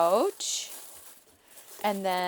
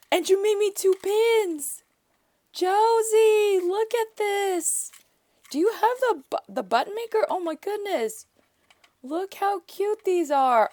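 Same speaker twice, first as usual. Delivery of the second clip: gushing squeal; 11 semitones higher, 6 dB louder than usual. Real, not acted. Gushing over handmade pins.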